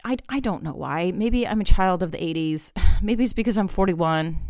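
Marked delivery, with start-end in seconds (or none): none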